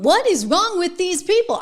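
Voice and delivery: usual speaking voice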